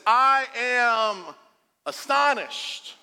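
high, drawn-out, indignant disbelief; ticked-off astonishment. Retold or imitated. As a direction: stretch each word in exasperated outrage. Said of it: "it literally means ticked off, angry, frustrated".

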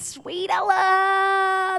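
high, very slow saccharine tone; mock sweet. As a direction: draw it out like she's an angel, sarcastically. Mock sweet naming of daughter.

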